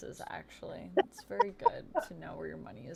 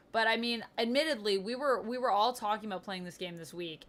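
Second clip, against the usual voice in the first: normal talking voice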